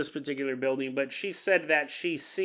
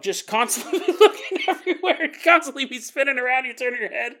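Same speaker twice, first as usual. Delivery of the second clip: ordinary talk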